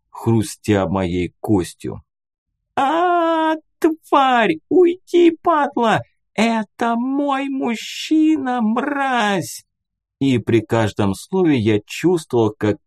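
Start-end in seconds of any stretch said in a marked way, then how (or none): 2.8-9.7 furious screaming. Acted. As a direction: shriek abuse at the beast in rage. Woman attacking dog defending man.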